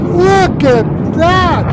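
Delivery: a long marvelling cry of look at that; awestruck. Real, not acted. Vlogger marvels at the blast video, high and slow.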